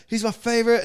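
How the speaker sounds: loud, high excited gush; retold fan excitement at full volume. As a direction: act out a thrilled superfan. Imitating a thrilled fan.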